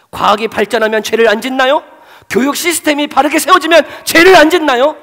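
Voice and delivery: loud and high, pressing the question home; rising preacher's challenge. Real, not acted. Preacher challenging the congregation, loud and high.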